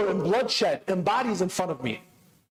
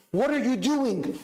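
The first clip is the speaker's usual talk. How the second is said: ordinary talk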